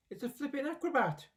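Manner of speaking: high delighted cry from the roof; excited shout. A narrator's reading. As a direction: whoop it out with glee. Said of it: "Grandma shouted from the rooftop".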